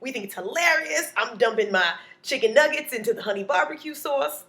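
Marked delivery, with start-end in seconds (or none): none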